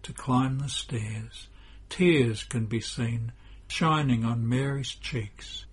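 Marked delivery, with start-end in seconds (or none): none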